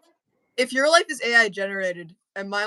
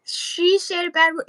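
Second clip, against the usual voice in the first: mock childish voice; 9 semitones higher than usual. Repeating the joke.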